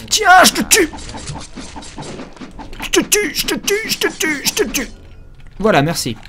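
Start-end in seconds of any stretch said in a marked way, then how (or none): 0.1-4.9 very high squeaky little voice; squeaky mock killer. Player to enemies while shooting, high squeaky mock killer. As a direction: repeat it in a high-pitched comic squeak.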